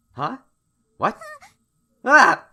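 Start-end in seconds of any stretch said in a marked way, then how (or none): 2.0-2.4 sudden high, loud yelp; startled yelp. Acted. Player reacts to the game's collision, startled yelp. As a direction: let out a startled "Ah!". Startled cry at on-screen event.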